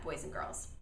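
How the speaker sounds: trailing whisper. Real, not acted. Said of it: "trailing end of sentence".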